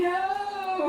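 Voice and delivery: high, loud cry of refusal; shouted no. Retold or imitated. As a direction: yell it like a protest. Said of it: "She's going".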